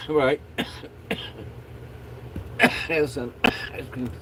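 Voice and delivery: coughing fit between words; stoner. Real, not acted. Coughing fit while talking, smoking.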